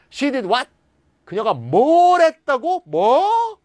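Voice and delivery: high, loud, rising to a shocked 뭐; incredulous what. Shown as a demonstration. Teacher acting out shocked disbelief, high and loud. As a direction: disbelief building to a cry. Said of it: "이렇게".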